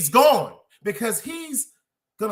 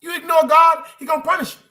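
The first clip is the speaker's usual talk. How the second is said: louder, higher warning from the pulpit; preaching warning; 8 semitones higher, 8 dB louder than usual. Acted. Preacher warning the crowd about God, loud preaching. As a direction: thunder it like a preacher.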